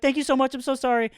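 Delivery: mock Mexican accent, pitched high; light imitation of an apologetic Mexican-accented cashier. Retelling the cashier's thanks in a mock Mexican accent. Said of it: "thick Mexican accent".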